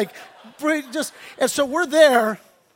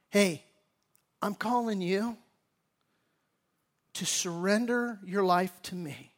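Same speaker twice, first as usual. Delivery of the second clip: gentle call of the Lord; 3 semitones lower, 7 dB quieter than usual. Pretend. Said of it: "the Lord might say to you".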